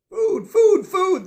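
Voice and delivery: high, loud squawking cry; shrill it out like hungry jays; blue jays crying. A narrator's reading. Jays calling the hillside to food, squawking high. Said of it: "they cried again and again".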